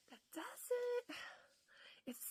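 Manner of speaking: hushed whisper to herself. Retold or imitated. Re-tasting the sweet mayonnaise, puzzled whisper to herself. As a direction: whisper it like a private puzzled thought. Retelling tasting the burger again.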